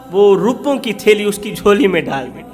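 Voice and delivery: ordinary talk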